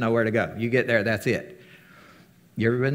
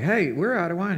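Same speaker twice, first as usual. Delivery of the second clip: lightly voiced guests; 7 semitones higher than usual.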